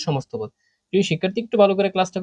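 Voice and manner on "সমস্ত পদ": সমস্ত পদ repeated lower and softer, falling to close. Shown as a demonstration. Teacher quietly echoes the answer so students remember it. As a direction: settle the answer with a quiet echo. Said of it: "এই পদটাকে বলা হয় কি?".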